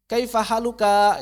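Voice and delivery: slow "Kaifa haluka" with drawn-out final "ka"; high, loud "haluka", last vowel stretched; misplaced mad. Retold or imitated. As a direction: imitate wrong vowel lengths in "haluka". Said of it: "madnya nggak diperhatikan".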